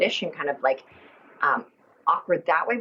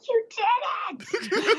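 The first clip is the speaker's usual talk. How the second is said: much higher, slow, laughing shout of wonder; Will Ferrell as Buddy the Elf; much slower than usual. Retold or imitated. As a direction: cheer like a wide-eyed elf. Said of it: "he's like".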